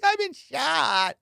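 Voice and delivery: high, slow mock cry of pain; mock crying for his life. Imitating the shot dog walker, high slow mock whimper. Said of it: "crying for his life".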